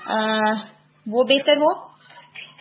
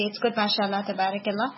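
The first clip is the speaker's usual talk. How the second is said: normal talking voice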